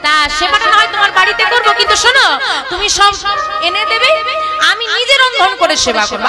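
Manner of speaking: agree, but set your conditions; a priest laying down terms to the woman; grudging priest. Acted.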